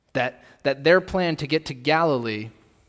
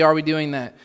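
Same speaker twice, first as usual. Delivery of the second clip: "are we doing that?" pushed louder; louder repeat; 2 semitones higher, 8 dB louder than usual. Shown as a demonstration. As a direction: press the question harder.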